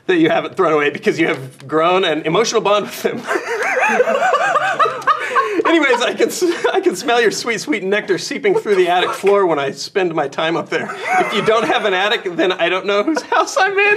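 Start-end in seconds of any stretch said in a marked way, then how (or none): 3.2-5.6 high burst of laughter. Real, not acted. Laughing reading letter. 5.7-14.0 laughing. Real, not acted. Reading letter while laughing.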